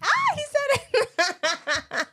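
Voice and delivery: high, loud, drawn-out laughing exclamation; gleeful shriek. Real, not acted. Wife catches husband finally saying period, gleeful shriek. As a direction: crow in triumph through laughter.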